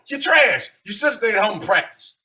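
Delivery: ordinary talk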